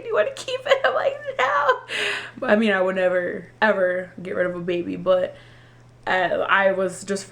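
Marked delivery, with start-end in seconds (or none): 0.0-1.8 high mock exchange. Nurse question and her flat no.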